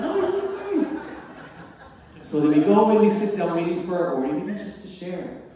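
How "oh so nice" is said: laughing gush. Retold or imitated. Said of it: "I've drastically changed".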